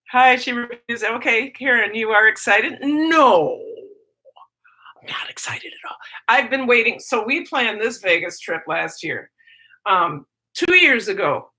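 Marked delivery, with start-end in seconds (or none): 5.0-6.0 mock-sarcastic whisper. Teacher joking to viewers she is not excited, whisper. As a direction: breathe it out as a joke denial.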